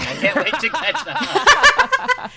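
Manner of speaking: high laughing voice. Real, not acted. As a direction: squeal it through laughter.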